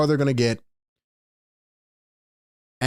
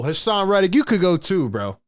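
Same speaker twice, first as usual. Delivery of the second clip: ordinary talk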